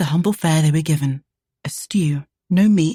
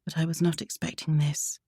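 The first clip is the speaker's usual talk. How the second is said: soft; 7 dB quieter than usual. A narrator's reading. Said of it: "she said softly".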